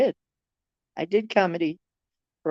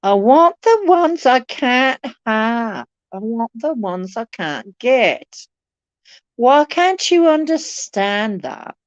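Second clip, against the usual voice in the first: melodramatic lament; 6 semitones higher, 3 dB louder, slower than usual. Pretend. Dramatic mock lament.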